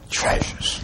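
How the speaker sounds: hushed whisper on treasures. Acted. Preacher whispers to the congregation. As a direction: breathe the word treasures out.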